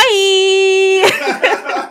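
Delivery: badly faked star greeting, drawn out; Idris Elba. Host's best impersonation, silly drawn-out hello. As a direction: joke impression, long high-pitched greeting. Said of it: "Wanna hear my best Idris Elba impress".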